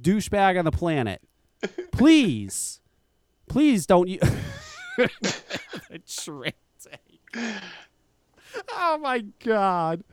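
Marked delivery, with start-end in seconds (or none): 4.2-10.0 words squeezed out through laughter, slow and soft; can't get the words out for laughing. Real, not acted. Host cracking up over the trick tank, laughing. Breaks up laughing mid-sentence.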